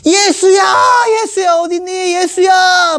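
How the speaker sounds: put-on high calling for a lost child. Joseph searches the temple, calling the boy's name. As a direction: call across the crowd.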